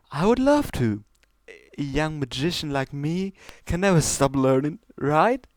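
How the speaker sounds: high, delighted showmare voice; eager Trixie. Acted. Trixie accepting the stallion's lessons, high and delighted. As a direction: gush with delight.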